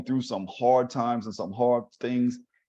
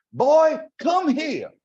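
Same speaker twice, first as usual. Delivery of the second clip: high, loud and drawn-out motherly command; scolding mother; 14 semitones higher, 6 dB louder, much slower than usual. Retold or imitated. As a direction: call him over like a stern mama. Mother grabs him by arm.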